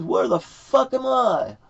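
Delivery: high, drawn-out indignant question; slow, high-pitched baffled outcry; acting out bewildered Jonah. Man spat out by the whale at Nineveh, high-pitched bewilderment. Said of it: "he says".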